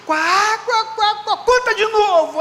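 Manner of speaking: high, loud mock cackling; imitated guffawing woman. Sister Sueli begging for the story again, imitated loud guffawing. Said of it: "a irmã Sueli dava gargalhada, falou".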